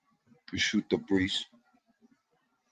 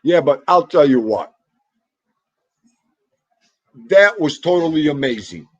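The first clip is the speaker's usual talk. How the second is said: loud and excited, a little higher; 5 semitones higher, 9 dB louder than usual. Real, not acted. Excited to the chat about the perfect game. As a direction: burst out with real excitement.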